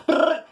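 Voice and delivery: rapid-fire punching noise; high rolled sound effect for flying fists; high trilled brrr. Retold or imitated. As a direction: trill out a burst of blows. Imitates a flurry of punches.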